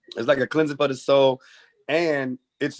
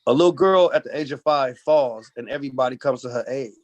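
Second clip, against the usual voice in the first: normal talking voice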